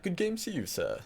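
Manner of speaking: high, plummy upper-class toast; lightly voiced posh gentleman; posh accent. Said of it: "speak in, like, a posh accent".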